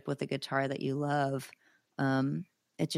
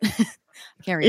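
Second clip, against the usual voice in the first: louder, higher, laughing repeat; 4 semitones higher, 9 dB louder, much slower than usual. Real, not acted. Guitarist amused at their own excuse about the knob, laughing. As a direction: say it through a laugh.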